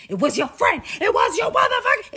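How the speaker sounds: loud, high enraged yelling at a passenger; full-volume, high-pitched outburst; crazed angry shouting. Retold or imitated. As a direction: explode into a furious accusation. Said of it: "is now crazy crazy".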